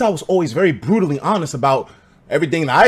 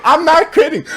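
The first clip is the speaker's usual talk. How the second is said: higher, laughing through it; laughing insistence; 11 semitones higher, 4 dB louder than usual. Real, not acted. Me insisting to viewers, laughing. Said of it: "insisting, laughing".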